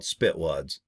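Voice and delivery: ordinary talk, nothing marked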